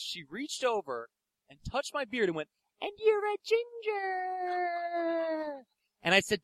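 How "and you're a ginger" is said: very high, drawn-out mock squeal of delight; gushing fan imitation. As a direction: stretch it out in a high, silly coo. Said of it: "And then she did this".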